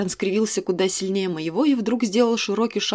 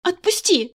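much higher, loud demand to let go; sharp cry; 10 semitones higher, 4 dB louder than usual. Acted. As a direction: yank free with the word. Heroine struggles in his grip.

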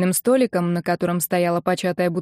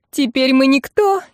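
high wail, slowing to a drawl; relentless lament; 6 semitones higher, 3 dB louder, slower than usual. A narrator's reading. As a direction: keep bemoaning the family's ruin. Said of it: "не переставала Ирка". (не переставая причитает)

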